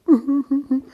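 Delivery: high boo-hoo whimper; mock sobbing. Human crying over a breakup, mock sobs. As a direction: sob in a hammed-up way. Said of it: "We get upset".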